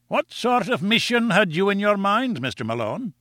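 high-pitched, kindly Scottish editor; kindly Scotch accent. A narrator's reading. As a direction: greet him in a high, genial Scots voice. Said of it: "in his kindly Scotch accent".

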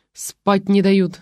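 ordinary delivery, nothing marked

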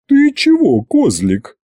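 put-on high puzzled old woman; baffled witch. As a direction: ask with big surprise. Могучая тётка недоумевает. (недоумение Ягуты)

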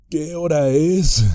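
drowsy, slow question; yawning, just woken up; sleepy. A narrator's reading. Sam pretending to wake after overhearing Gollum, sleepy voice. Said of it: "preguntó con voz soñolienta".

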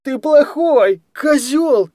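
put-on hurt boy screams back and runs off; childish crying shout. A narrator's reading. Kostya, just slapped, yelling at the guardian, high and loud. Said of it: "крикнул он и выбежал".

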